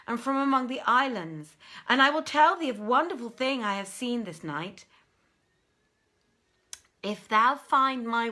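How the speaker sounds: loud, high eager pleading tale; urgent Ifrit. Acted. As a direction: press his bargain, voice climbing. Ifrit tells his tale.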